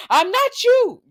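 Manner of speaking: high, loud indignant outburst; upset mock yell. Girl telling off Nina, hammed-up indignation. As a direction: mimic an upset girl yelling it. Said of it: "you was all upset telling Nina".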